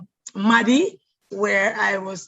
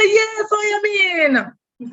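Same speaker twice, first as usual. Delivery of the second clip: Sophia voice; 11 semitones higher, 6 dB louder, much slower than usual. Retold or imitated. Voicing Sophia again.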